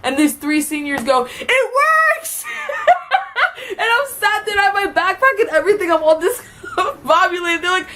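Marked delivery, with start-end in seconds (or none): 1.4-2.5 mock triumphant cheer. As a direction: crow like pranksters whose trap worked. Seniors cheering the prank.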